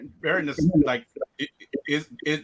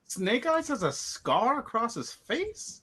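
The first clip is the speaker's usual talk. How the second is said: slow, higher-pitched disbelief; incredulous question; 6 semitones higher, slower than usual. Real, not acted. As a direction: draw the question out in real surprise. Disbelieving question about new fact.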